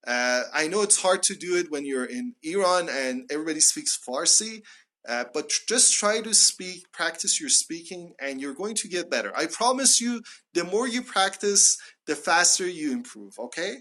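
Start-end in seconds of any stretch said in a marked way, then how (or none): none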